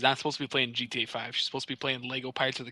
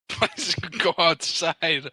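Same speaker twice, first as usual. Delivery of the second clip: exasperated mock plea; 5 semitones higher, 6 dB louder, much slower than usual. Mocking Roblox players.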